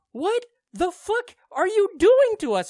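high, slow, outraged cry at the show; mock outrage, drawn out and high; exasperated outcry. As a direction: throw your hands up in disbelief.

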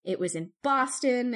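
ordinary talk, nothing marked

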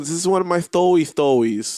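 mock Elmer Fudd. Friend quoting her on the podcast, mocking Elmer Fudd speech. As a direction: say stories as "thtowy thtowies". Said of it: "and she goes".

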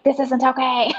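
fired-up protest at what the family lost; pissed off. Retold or imitated. Telling the host her old fury, a touch indignant. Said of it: "I was getting really pissed off".

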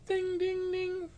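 very high sung ding-ding of a car alarm; door chime. Retold or imitated. Driver mimicking the car's open-door chime, very high.

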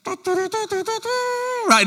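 high mock trumpet fanfare; imitated fanfare. Preacher announcing Naaman's arrival, high imitated fanfare. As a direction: herald an arrival with a long, high mouth fanfare.

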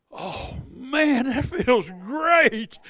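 slow, high, laughing delight; laughing relief. Retold or imitated. Pastor feeling his lungs healed, slow laughing relief. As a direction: savor it with a chuckle.

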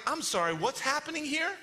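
high, incredulous question; mock bafflement. Preacher reacting to Peter's sudden request, mock baffled. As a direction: question it in comic disbelief.

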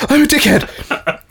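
mock gasping confession; breathless confession. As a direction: blurt it out as if you just ran in. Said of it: "Bursting breathlessly into a room and going".

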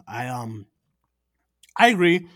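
talking around ice in mouth; words round a mouthful of ice; mouth full. Real, not acted. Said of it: "Sorry, I have ice in my mouth".

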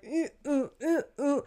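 awkward high humming. Retold or imitated. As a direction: hum awkwardly, avoiding the question. Mimicking awkward hum.

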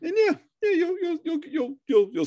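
nervous stammer, repeating words; stuttering. Real, not acted. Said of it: "So I'm stuttering because".

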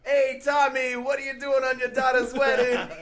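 brash Italian-American wedding guest calling out; mock Italian-American accent. Guest calls out to Tommy at the wedding, broad Italian-American accent. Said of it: "the accents you are doing".

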